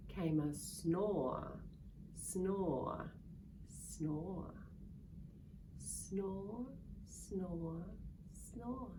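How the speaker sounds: soft, slow snore sounds; mock snoring. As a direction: snore sleepily.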